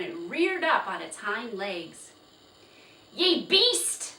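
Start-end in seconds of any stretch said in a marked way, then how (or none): 3.1-4.1 high, loud furious shout; angry shout. Acted. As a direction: hurl it at the horse. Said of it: "Mister Nye shouted".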